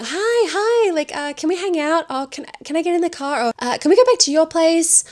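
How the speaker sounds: high-pitched, tentative gushing; eager clingy girl. Retold or imitated. Nikki pleading to hang out with Bear, eager and clingy. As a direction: pitch it way up, stumbling over eager little requests. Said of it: "she's saying".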